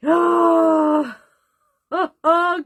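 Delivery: loud, high wail of disbelief, then laughter; exasperated cry into laugh. Real, not acted. Finding the lost car keys in her bra, a loud, high wail turning to laughter.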